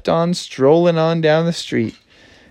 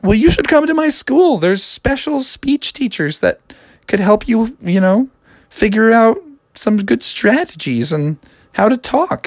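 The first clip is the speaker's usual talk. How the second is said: higher, eager frog voice; Froggy voice; 8 semitones higher than usual. Acted. Froggy inviting the princess, higher voice. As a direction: sell the school to her. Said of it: "Froggy was like".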